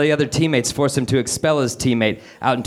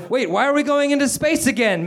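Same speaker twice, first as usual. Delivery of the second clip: managed scream; 12 semitones higher than usual. Acted. Meg protesting from around the device, high mock scream. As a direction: squeeze it out high and strained. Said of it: "Meg Murray managed to scream".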